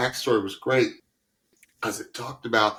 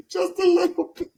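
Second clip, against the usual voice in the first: much higher and louder, laughing; high laughing demo; 6 dB louder than usual. Shown as a demonstration. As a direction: squeal it up high through a laugh. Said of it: "I'm a little loopy".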